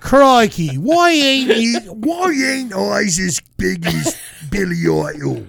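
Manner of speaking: loud, high, stretched mock Australian; Australian accent. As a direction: ham up a broad Australian twang. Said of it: "He's Australian now".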